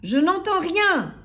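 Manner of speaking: slow, high, loud holler; thundering grocer. A narrator's reading. Grocer dismissing timid customer, thundering. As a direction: thunder it out, stretching the words. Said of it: "tonna l'épicier".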